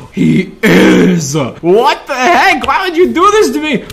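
loud startled outcry; shocked. Real, not acted. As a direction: yell in real shock. Huggy Wuggy jump scare.

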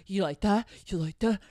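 mock of a pushy guy asking again; mock creepy guy. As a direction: parrot his smug question. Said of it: "He's like".

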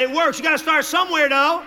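ordinary talk, nothing marked

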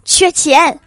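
loud, high, vicious outburst; fierce retort. Acted. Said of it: "恶狠狠地说".

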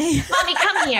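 put-on high-pitched child summoning mommy; demanding little boy. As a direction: imitate a bossy small child. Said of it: "he'll just be like".

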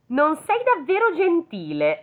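lightly voiced serene fairy; composed fairy. A narrator's reading. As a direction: stay calm and gracious. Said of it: "rispose la fata senza scomporsi".

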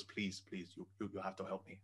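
low, soft retold plea; hushed pleading. Friend begs the storyteller for help, hushed pleading.

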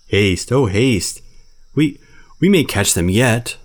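loud, slow, out of breath; panted. Acted. Baloo trotting after the monkeys, panting loud and slow. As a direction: gasp it out while running. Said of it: "Baloo panted".

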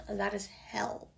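usual speaking voice

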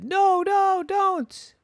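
very high, panicky plea; squeaky desperate no no; pleading. Acted. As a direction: beg the mechanic to stop. Wolf begs the mechanic.

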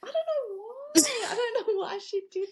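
high-pitched talk through giggles; giggling. Real, not acted. Reacting to co-host's haircut quip, giggling. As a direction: try to talk while cracking up.

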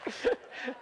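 high amused laugh; laughing at the joke. Real, not acted. Pastor laughing at his own joke with the congregation, amused. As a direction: let the laugh ring out.

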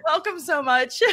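laughing. Real, not acted. Welcoming friend while laughing.

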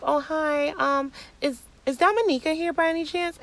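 high-pitched, tentative polite question; polite inquiry. Retold or imitated. Asking a woman inside whether her friend is here, politely.

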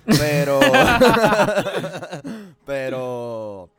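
laughing mid-sentence. Real, not acted. Laughs at own quoted story.

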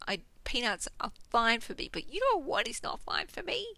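ordinary talk, nothing marked

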